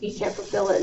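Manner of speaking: usual speaking voice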